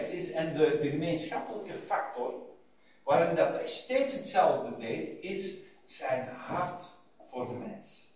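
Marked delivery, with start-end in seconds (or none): 5.2-8.0 slow, dropping to a hush; tender, slow. Acted. Preacher to congregation about Jesus' heart, slow and hushed. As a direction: linger on the heart, then fade.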